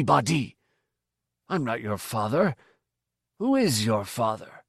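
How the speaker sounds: old villager's voice, mock-indignant teasing; teasing old man. A narrator's reading. Old man quizzes the stranger over a greeting, teasing. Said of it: "more teasing than aggressive".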